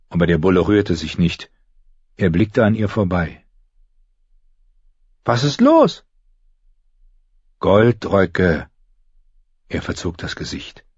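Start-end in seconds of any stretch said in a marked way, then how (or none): 5.2-6.0 put-on high girlish question; high-voiced puzzled child; young girl Arya, puzzled. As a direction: ask it high, rising sharply in pitch.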